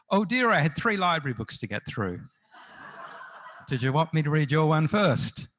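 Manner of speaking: a hint of a fond, dotty mum, chuckling; mum's voice, retold. Retold phone call, mother answering.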